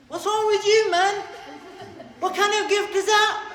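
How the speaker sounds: high, fast outraged disbelief with laughs; incredulous kid. Retold or imitated. Child reacting to an odd request from a guest, incredulous. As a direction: squeal it in shocked disbelief. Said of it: "And you'd be like".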